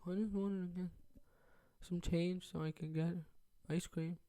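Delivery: put-on soft, meek little-kid voice; small scared kid. Caught kid mumbling excuse to angry stepmother, meek and soft. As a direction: shrink down like a caught twelve-year-old.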